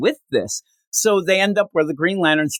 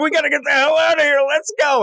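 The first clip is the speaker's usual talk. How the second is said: panicked robot pilot; 13 semitones higher than usual. Retold or imitated. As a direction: squeal it out like a terrified droid fleeing. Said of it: "He's like".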